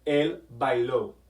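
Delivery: "él bailó" said slowly with the stress on the final ó of bailó; correct stress on bailó. Shown as a demonstration. Teacher modelling "él bailó" with stress on the final ó. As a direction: model "él bailó" with the last syllable stressed, like bai-LÓ. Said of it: "And you say it".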